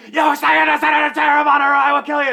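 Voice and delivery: high, loud mock shout of threat; furious mother. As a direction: scream the threat like a crazed mother. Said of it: "then was, like, literally like".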